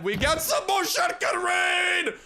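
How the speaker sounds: high, slow triumphant holler; over-the-top hyped yell; excited shout. Pretend. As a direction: shout it out in drawn-out celebration. Celebrating more shotgun rain.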